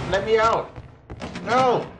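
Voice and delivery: begging to be let out, rising; panicked plea. Real, not acted. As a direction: cry out in panic. Player panicking in obstacle course.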